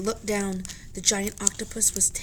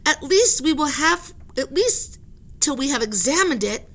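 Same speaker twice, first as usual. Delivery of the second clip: insistent argument; 6 semitones higher, 6 dB louder than usual. Acted. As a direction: argue for science. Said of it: "said the professor".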